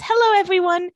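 bright, high, loud stage greeting; a loud, high hostess hello to the pageant crowd. Retold or imitated. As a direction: welcome the crowd like a pageant host. Caroline takes the stage.

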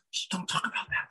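hushed whisper, urgent; mock whisper. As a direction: whisper it so nobody hears. Imitating Larissa hushing Adriana.

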